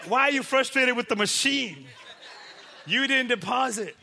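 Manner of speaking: loud, high-pitched comic scolding; exasperated heckling. Acted. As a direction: shout it in mock disbelief. Heckling frustrated cash machine user.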